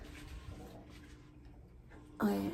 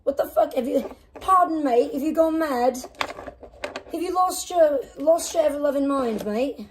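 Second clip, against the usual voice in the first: higher, hammed-up British bloke; mock British accent; 5 semitones higher than usual. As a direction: play an outraged English bird. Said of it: "She's like".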